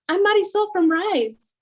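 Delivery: high, slow imitated introduction; imitating the lady's introduction; introducing herself. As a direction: mimic her cheerful self-introduction. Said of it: "she goes".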